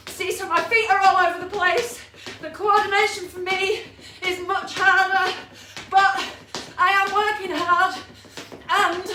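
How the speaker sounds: gasping the words out after a sprint; out of breath. Real, not acted. Fitness instructor just finished fast feet, talking out of breath. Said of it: "I'm out of breath".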